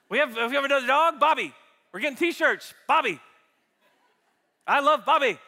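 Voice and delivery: ordinary talk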